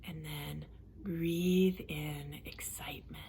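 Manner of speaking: calm relaxation coach leading a breathing exercise; guided relaxation. Acted. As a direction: guide them gently through breathing, unhurried. Leads a guided relaxation session.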